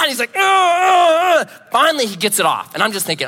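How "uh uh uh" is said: loud high strained groans; imitated cries of a man in agony; pained cries. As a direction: groan like the fangs are still in. Bitten man in pain.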